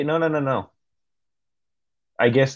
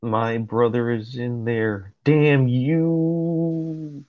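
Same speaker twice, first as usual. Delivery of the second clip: mock-dramatic villain line; dramatic character reading; 3 semitones higher, much slower than usual. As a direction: ham up the curse, stretching the last word.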